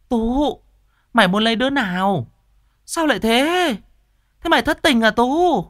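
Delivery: high, fast, anxious flurry rising to a shrill cry; fretting old mother. Acted. Mother answering the healer then pestering her son, anxious and rising.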